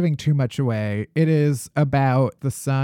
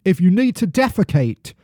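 put-on hammy British accent, slipping as it goes; 4 semitones higher, 4 dB louder than usual. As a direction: read it out in a shaky attempt at British. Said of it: "I'm really losing this accent".

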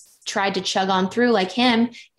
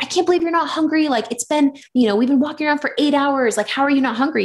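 exasperated; 6 semitones higher than usual. Retold or imitated. Retold exasperated outburst at partner.

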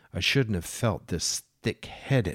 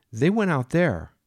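higher, louder voice for Jane; a mad woman serving the captain breakfast; 8 semitones higher, 6 dB louder than usual. Acted. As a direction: play her as doting and unhinged.

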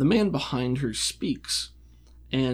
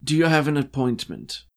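bored tone; 2 semitones higher, 4 dB louder than usual. Acted. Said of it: "she says in a bored tone".

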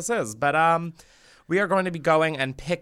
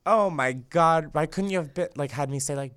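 ordinary talk

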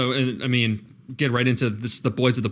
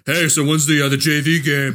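a hint of an overgrown teen jock, a bit louder; 3 dB louder than usual. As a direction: lightly voice a bearded man asking about the game. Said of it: "being like".

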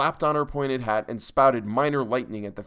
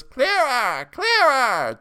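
high, loud cackling demand; a crone insisting, high and shrill-loud; high petulant witch demand; 9 dB louder, much slower than usual. A narrator's reading. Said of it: "demanded Jenny".

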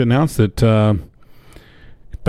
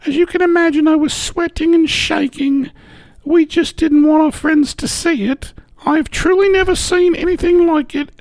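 high woman voice. Retold or imitated. Reading Karen's quote in voice.

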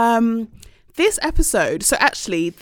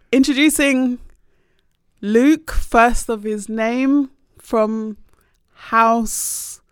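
slow, grand herald-style announcement; Game of Thrones introduction; 4 semitones higher, 4 dB louder, much slower than usual. Pretend. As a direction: announce him like a royal herald. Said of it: "introduce in a Game of Thrones way".

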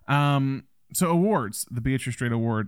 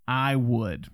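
ordinary talk

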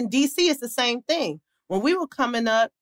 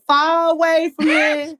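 slow, higher D C crowd singalong; D C crowd accent; 6 semitones higher, 3 dB louder, slower than usual. Retold or imitated. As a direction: drag it out like a hometown D C crowd. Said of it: "the crowd starts singing Far Away".

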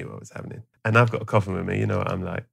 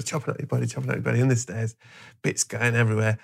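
usual speaking voice

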